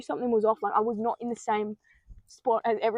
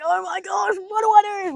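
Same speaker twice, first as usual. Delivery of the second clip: high, louder panicked cry; scared cry; 9 semitones higher, 5 dB louder than usual. Retold or imitated. Narrator reenacting her terror on the other side, shouting. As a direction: yell it out in rising fear. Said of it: "I was a bit more scared than this".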